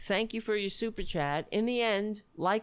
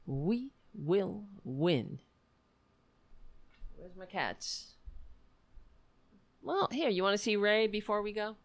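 ordinary talk, nothing marked

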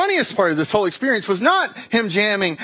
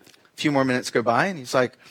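ordinary talk

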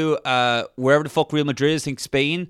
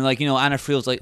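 ordinary delivery

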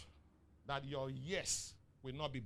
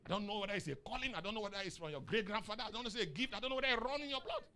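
building up; 6 semitones higher, 3 dB louder, faster than usual. Real, not acted. Preacher marvels at stoic listeners.

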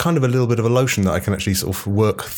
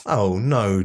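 ordinary delivery

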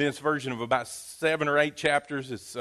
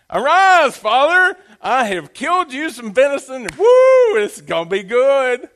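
excited Esau; 9 semitones higher, 11 dB louder than usual. Acted.

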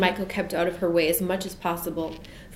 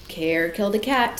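ordinary delivery, nothing marked

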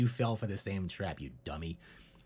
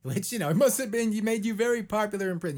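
higher teasing put-on voice; mocking voice; 11 semitones higher, 6 dB louder, faster than usual. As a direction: tease him in a high mocking voice. Mocking him about prison.

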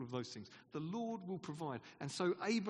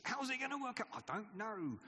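flustered theatre director; 6 semitones higher than usual. Retold or imitated. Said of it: "a theatre director who's always out of his depth".

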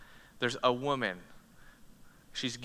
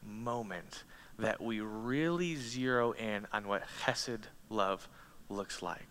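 normal talking voice

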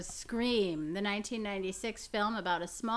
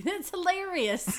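mock delight; 9 semitones higher, 4 dB louder than usual.

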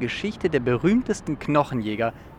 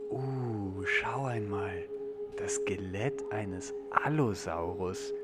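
soft, low and slow with wonder; hushed awe; 5 semitones lower, 6 dB quieter, slower than usual. Acted. Visitor seeing the Allosaurus skeleton, hushed awe. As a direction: breathe it out, marvelling.

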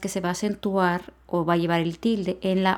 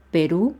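"Perú" stressed on RÚ, rising; stress on last syllable. Shown as a demonstration. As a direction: lean on the final ú of "Perú". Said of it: "el acento va en la U".

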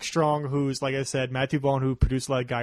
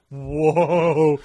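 drawn-out, louder "whoa"; imitated shock; 6 dB louder than usual. Movie fan voicing an unprepared viewer, shocked. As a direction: act out a stunned viewer.

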